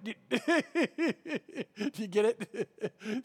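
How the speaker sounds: laughing through a slow question. Real, not acted. Preacher checks the congregation got his joke, laughing. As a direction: chuckle as you check they got it.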